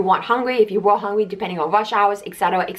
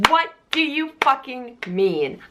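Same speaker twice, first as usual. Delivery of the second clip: slow, drawn-out mock outrage at the coworker; mock angry yell; 4 semitones higher, slower than usual. Boss yelling at a coworker for helping, slow mock outrage. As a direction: stretch each word out in mock fury.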